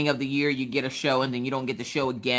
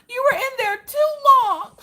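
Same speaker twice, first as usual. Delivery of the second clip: very high, louder hammed-up sob; mock crying complaint; 7 dB louder than usual. Clingy wife to husband back from bathroom, mock sobbing. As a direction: whine it through fake tears. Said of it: "and I'm like".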